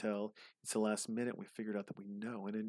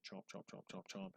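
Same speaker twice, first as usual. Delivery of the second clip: soft breathy chomp chomp, imitating an eating video; mock chewing sounds; 11 dB quieter than usual. Mocking YouTube eaters with soft chomping noises. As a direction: whisper the chomps like a mouthful. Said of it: "someone's like".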